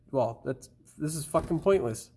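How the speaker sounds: ordinary delivery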